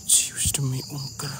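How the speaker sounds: hushed whisper, slow and careful. Real, not acted. Explorer whispering a charm in the dark house. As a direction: whisper so nothing hears you.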